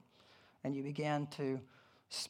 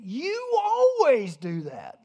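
slow, much higher and louder accusation; mock accusing; 7 semitones higher, 9 dB louder, much slower than usual. Spouse accusing partner in an argument, hammed-up and slow. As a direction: drag out the blame like a spouse mid-fight. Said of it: "Here's the typical saying".

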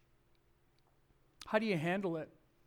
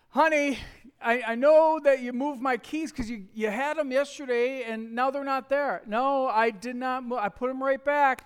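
louder and higher, firm and insistent; husband and wife arguing over lost keys, raised voices; heated insisting; 6 semitones higher, 8 dB louder than usual. Retold or imitated. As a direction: argue your side, voice raised.

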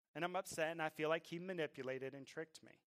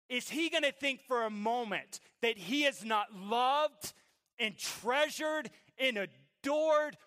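slow, loud preacher's build to full force; impassioned vow; 7 semitones higher, 11 dB louder, much slower than usual. Retold or imitated. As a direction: build from firm refusal to raised, drawn-out proclamation. Preaches his vow for his son.

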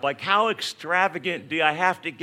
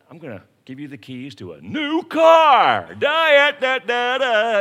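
loud shouted reveal with fanfare; triumphant announcement; 7 semitones higher, 11 dB louder than usual. Retold or imitated. Cop announcing a new car gift, shouted fanfare. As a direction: blast it out like a game show.

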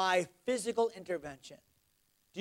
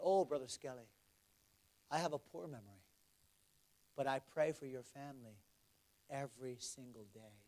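low, soft, humble confession; hushed and sincere; 7 semitones lower, 12 dB quieter than usual. Retold or imitated. Missionary admitting he prays daily for the family, quiet and low. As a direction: let it sink almost to a murmur.

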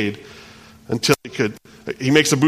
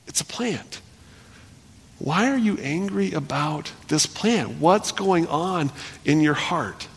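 gentle question; 7 semitones higher, slower than usual. Acted. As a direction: ask it tenderly, almost under the breath. God speaking gently to Jonah.

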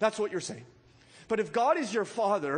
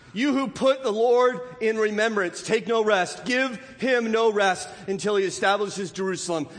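loud, raised, emphatic pulpit voice; forceful preaching; 4 semitones higher, 7 dB louder than usual. Real, not acted. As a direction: drive it home to the congregation, loudly. Preaches with raised force, reading scripture.

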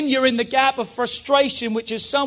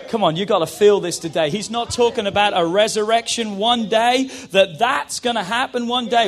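ordinary talk

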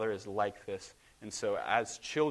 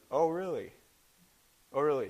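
higher-pitched mock-incredulous comeback; playful challenge; 6 semitones higher, 5 dB louder than usual. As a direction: rise to the challenge with teasing disbelief. God playfully answering a challenge.

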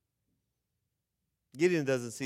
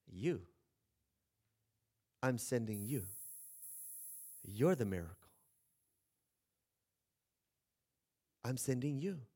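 low, soft and unhurried; calm divine answer; 6 semitones lower, 8 dB quieter than usual. Retold or imitated. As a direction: quietly hand him his calling. God answers Gideon.

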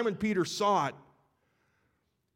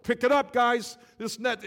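yelling to the boat; 6 semitones higher than usual. Acted. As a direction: shout at the partners to hurry. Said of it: "they're yelling to the boat".

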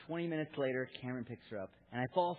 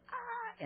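very high, held "ah"; high-pitched mock baby shriek; imitated toddler squeal; 4 dB quieter than usual. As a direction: squeal like a chubby toddler, not too loud. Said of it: "like, loud chub".

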